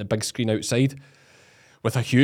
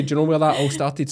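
ordinary talk, nothing marked

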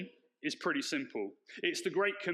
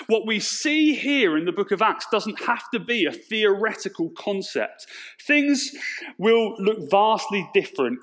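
loud, passionate sermon voice; preaching; 11 dB louder than usual. Acted. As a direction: preach it out to the congregation. Sermon at full force.

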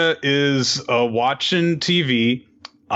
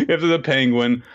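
usual speaking voice

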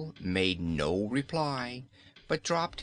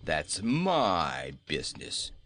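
low, slow surly goat; 5 semitones lower, slower than usual. Acted. Bilbil answering the Wizard, surly. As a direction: shut the question down. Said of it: "returned Bilbil in a surly tone".

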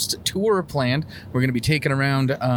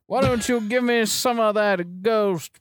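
breathy vintage purr; 9 semitones higher, slower than usual. Pretend. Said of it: "I gotta talk like the time".

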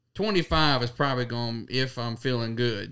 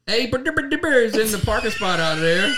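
mock announcer; 7 semitones higher, 3 dB louder than usual.

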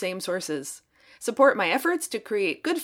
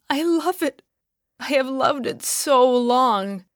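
weeping; 5 semitones higher, 5 dB louder than usual. A narrator's reading. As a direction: let it break with longing. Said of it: "wept the woman".

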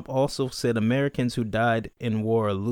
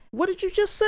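high, squeaky mock outrage; mock shocked squeal. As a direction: squeal it in fake disbelief. Mocking the lyrics, playful shock.

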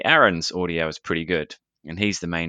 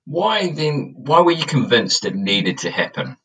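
cave echo; 7 semitones higher, 5 dB louder than usual. Real, not acted. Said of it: "I sound like I'm in a cave".

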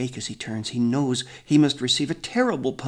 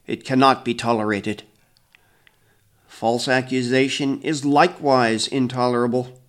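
stern elder; 7 dB louder than usual. A narrator's reading. Grandfather judges theft claim.

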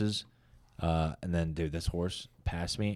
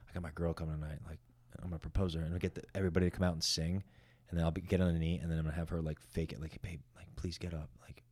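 softer, hushed plan to the host; softer mock confiding tone; planned quote; 4 semitones lower, 9 dB quieter, faster than usual. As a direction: quietly set it up with the host.

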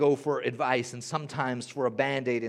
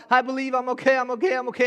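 insisting I'm okay; 10 semitones higher, 7 dB louder, faster than usual. Acted. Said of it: "you're trying to pull yourself out".

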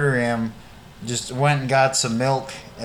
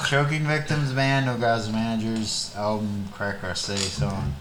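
low, soft, fading album mention; speaking too quietly; 5 semitones lower, 6 dB quieter than usual. Real, not acted. Naming a band to the co-host, quiet and low. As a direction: let it sink quieter and lower as it goes. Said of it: "You gotta speak up".

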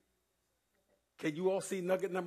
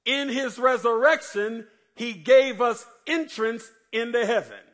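preaching; 7 semitones higher, 11 dB louder, slower than usual. Acted. Preaching the nuggets.

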